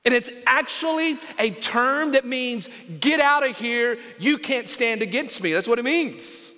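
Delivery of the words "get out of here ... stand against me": usual speaking voice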